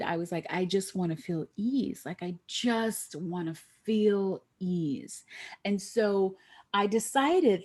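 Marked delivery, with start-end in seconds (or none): 2.1-5.2 same wish, drawn out slowly; slow longing. Retold or imitated. Tired executive longing for ease, slowly. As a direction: stretch each word of the wish.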